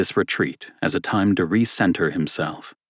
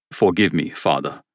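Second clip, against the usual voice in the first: put-on apologetic German-accented plea; a German visitor, blushing and sorry; German accent, embarrassed; 2 semitones lower than usual. Stranger apologizing to priest, embarrassed German accent. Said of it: "said a man with a thick German accent".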